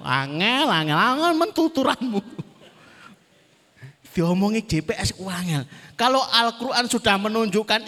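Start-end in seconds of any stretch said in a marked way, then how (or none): 0.1-2.2 a hint of the nagging female GPS voice, higher and louder. GPS scolds the driver who keeps ignoring it, higher and louder nagging. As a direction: play the fed-up navigator scolding a stubborn driver. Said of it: "Suaranya akan metu".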